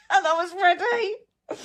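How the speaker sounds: high, tearful crack as she gets the words out; voice breaking. Real, not acted. Emotional after getting dressed.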